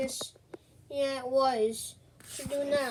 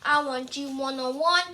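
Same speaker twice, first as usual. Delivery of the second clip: ordinary talk, nothing marked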